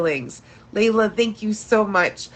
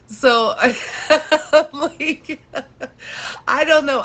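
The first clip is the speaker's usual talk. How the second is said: laughing through the words; 5 semitones higher than usual. Real, not acted. Laughing at own mouth breathing.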